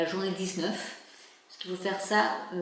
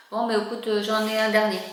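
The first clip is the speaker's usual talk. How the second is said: louder on the phone; 8 dB louder than usual. Real, not acted. Offers a code on the phone.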